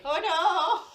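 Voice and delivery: high-pitched acted 'caught out' panic; mock dismay. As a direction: play at being exposed. Acts out being caught as fraud.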